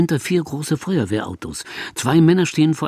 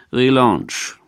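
lower, bored one word; 3 semitones lower than usual. A narrator's reading. Fireman answering the couple, bored. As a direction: shrug it off.

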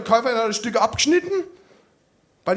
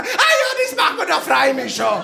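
chirpy agreement; 4 semitones higher than usual. Retold or imitated. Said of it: "hat meine Mutter gesagt".

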